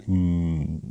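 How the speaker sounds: usual speaking voice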